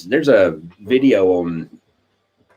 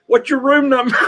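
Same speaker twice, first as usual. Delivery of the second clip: much higher, quick cheeky caddy voice; eager caddy quip; 4 dB louder than usual. Retold or imitated. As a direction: pop the punchline up high like an eager caddy. Caddy's punchline in retold joke.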